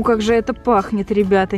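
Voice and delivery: ordinary talk, nothing marked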